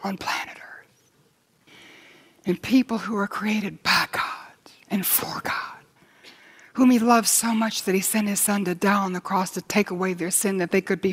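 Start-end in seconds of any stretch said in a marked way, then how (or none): 0.0-5.5 whispered, almost breathless; hushed whisper. Real, not acted. Preacher to his congregation, a hushed whisper. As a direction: lean in and whisper it. Preacher marvels at God's love.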